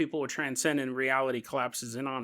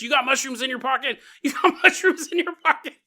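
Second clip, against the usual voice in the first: high, loud mock-cop accusation breaking into laughter; high, giggly mock interrogation; pretend cop frisking someone; 9 semitones higher, 5 dB louder, faster than usual. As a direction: play the cop catching them, higher and laughing on the repeat. Cop searching pockets, acted.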